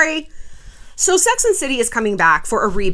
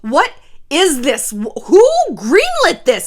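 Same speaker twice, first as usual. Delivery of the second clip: higher, louder and slow, aghast; outraged disbelief; 5 semitones higher, 5 dB louder, slower than usual. Retold or imitated. To the studio, who greenlit it, appalled. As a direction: demand who approved this trash. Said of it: "I'm like".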